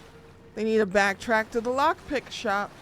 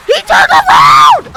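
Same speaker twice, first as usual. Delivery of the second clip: high, loud panicked yell; panicking as the monster turns around; panicked shout; 14 semitones higher, 18 dB louder than usual. Real, not acted. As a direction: scream the alarm. Enemy spots them.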